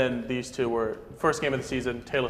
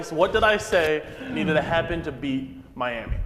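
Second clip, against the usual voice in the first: ordinary delivery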